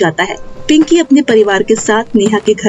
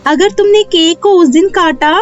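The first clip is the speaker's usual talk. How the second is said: high-pitched magical fairy voice; kindly fairy queen; 9 semitones higher than usual. Acted. Fairy hands Neha the magic cake with rules, kindly high voice. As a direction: give instructions as a fairy, pitched well up.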